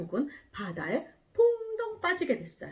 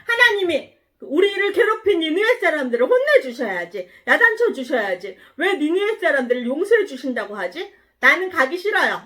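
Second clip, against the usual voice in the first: loud, indignant acted outburst; angry protest; 6 semitones higher, 7 dB louder than usual. Jonah refuses to forgive Nineveh, acted angry protest. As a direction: argue back, raised and fuming. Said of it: "요나가 너무너무 화가 났어요".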